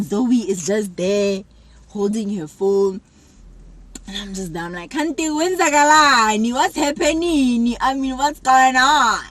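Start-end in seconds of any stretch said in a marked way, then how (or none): 4.9-9.2 loud demand. Retold or imitated. Bursting into room demanding answers.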